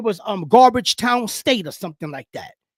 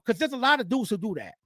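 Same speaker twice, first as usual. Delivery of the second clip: ordinary talk, nothing marked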